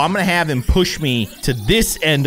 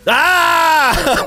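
sharp high yelp of fright; jolted into a scream by the jump scare; startled scream; 12 semitones higher, 4 dB louder than usual. Real, not acted. Player jump-scared, lets out a high startled scream.

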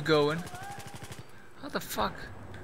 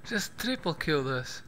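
ordinary delivery

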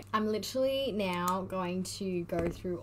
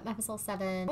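normal talking voice

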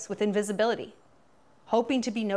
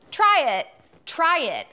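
urging; 6 semitones higher, 6 dB louder, slower than usual. Real, not acted. Urging Taylor to give back.

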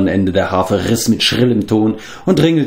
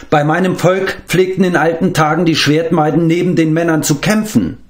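proud, higher-pitched retort with a touch of anger; indignant retort; 7 semitones higher than usual. A narrator's reading. Eilonwy defends her people's sword maidens, indignant. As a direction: stand up for yourself, affronted. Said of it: "Eilonwy schüttelte zornig den Kopf".

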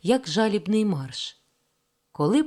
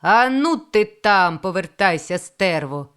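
slow, loud rough boss yell; retold rough shout; 5 dB louder than usual. Retired controller mimics a foreman, loud slow yell. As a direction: bark it like a foreman at a worker under the engine. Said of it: "прийде який чорт і кричить".

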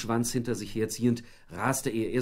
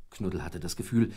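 usual speaking voice

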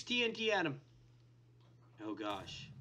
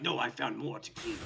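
very low, softer villain voice; voiced monster; 11 semitones lower, 5 dB quieter than usual. Player voicing the wither finding prey, low monster voice. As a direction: drop low to play the monster. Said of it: "He's like".

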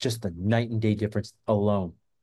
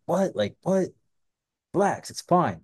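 higher-pitched mock bewilderment, then dismissive; imitated "what's the problem" reaction, pitched up; retold incredulous brush-off; 7 semitones higher than usual. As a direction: act out baffled protest, then wave it away. Retold protest to alarmed date.

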